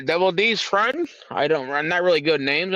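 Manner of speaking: ordinary talk, nothing marked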